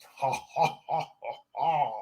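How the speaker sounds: lightly acted smug laugh; proud chuckle. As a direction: laugh it off, unbothered. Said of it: "chuckled with pride".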